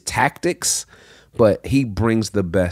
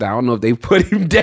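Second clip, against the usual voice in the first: laughing; 4 semitones higher, 5 dB louder, faster than usual. Real, not acted. Laughing at snake story.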